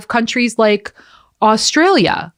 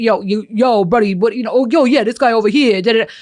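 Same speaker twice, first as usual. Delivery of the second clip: imitated old-time Brooklyn street accent, pitched up; heavy Brooklyn accent; 3 semitones higher than usual. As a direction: do a pronounced Brooklyn wise-guy accent. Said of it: "It was a lot more pronounced".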